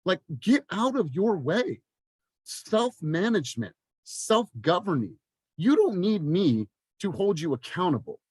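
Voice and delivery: fired-up coaching; passionate. Real, not acted. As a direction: press it home with fire. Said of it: "I'm just passionate about this".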